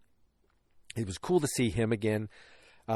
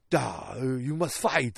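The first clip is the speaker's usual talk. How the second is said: mock Russian accent, slow, sliding into Arnold; a stern Russian father ordering, slow; much slower than usual. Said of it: "why am I all of a sudden Arnold?".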